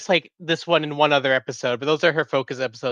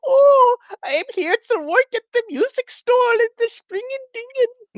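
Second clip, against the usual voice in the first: much higher, cartoonish fake Dutch accent; shittiest Dutch voice. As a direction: ham up a terrible Dutch accent, pitched way up. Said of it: "your shittiest Dutch voice".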